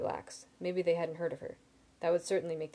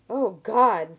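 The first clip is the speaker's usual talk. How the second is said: loud, higher, horrified exclamation; aghast; 4 semitones higher, 13 dB louder than usual. A narrator's reading. Simon realizing who the lender is, aghast. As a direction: exclaim in open dismay.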